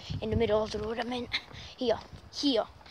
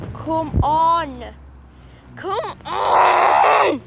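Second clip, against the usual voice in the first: loud, higher angry call to the dog; angry shout; 4 semitones higher, 10 dB louder, much slower than usual. Real, not acted. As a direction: yell it, fed up. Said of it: "I'm getting angry at him now".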